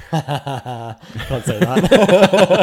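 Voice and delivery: laughing loudly; big laugh through the words. Real, not acted. Laughing at own joke.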